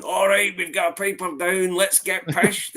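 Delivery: hammed-up Scottish movie line; mock Scots party cheer; quoting the film. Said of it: "the scene where he's going".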